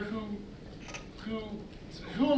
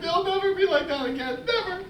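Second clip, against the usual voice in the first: tearful breakdown; 8 semitones higher, 4 dB louder than usual. Acted. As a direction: voice rising into sobs as he gives up. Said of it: "I didn't mean to get emotional".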